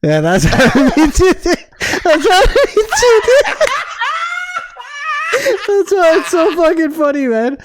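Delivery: high, helpless laughing delivery; real laughter bursting through the words; laughing hard. Real, not acted. Friend recognizes himself in the embarrassing story, laughing hard. Friend cracks up at shared experience.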